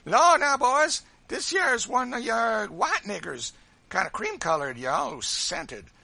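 high, loud jeering voice, drawn out; lively Sambo. A narrator's reading. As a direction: crow mockingly to an audience of boys. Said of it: "very lively, voluble".

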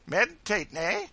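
high, loud teasing voice; facetious Sambo. A narrator's reading. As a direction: prod him with a jokey, boisterous question. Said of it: "poking him facetiously in the side".

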